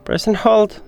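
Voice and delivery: ordinary delivery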